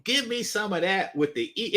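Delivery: put-on a light, half-hearted Russian accent, pitched a bit up; mock Russian accent. Retelling a porn scene, the Russian woman asking, in a mild mock Russian accent. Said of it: "in a Russian accent".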